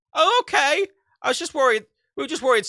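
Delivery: relaxed and chirpy once it's not a hacker; imitating the relieved ISP guy.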